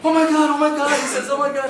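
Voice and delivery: high, loud panicky cries; panicked excitement. Retold or imitated. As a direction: blurt it as the fish dives. Fish runs under the boat.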